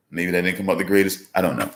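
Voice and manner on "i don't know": ordinary talk, nothing marked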